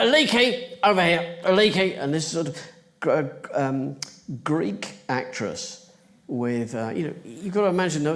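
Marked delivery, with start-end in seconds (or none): 0.0-2.0 raised, higher voice; calling out. Retold or imitated. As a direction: call someone over across a room. Director calling actress over in anecdote.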